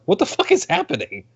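high disbelieving outburst; incredulous. Retold or imitated. Fan retells his shocked reaction to the movie, high and incredulous. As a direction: throw up your hands in disbelief.

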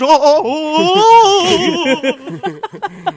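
drawn-out, rising mock clown laugh. Co-host imagines the manager falling off his bike, mock clown laugh. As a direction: whoop out long, high clown "oh"s. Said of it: "making that clown laugh".

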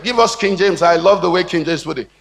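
ordinary talk, nothing marked

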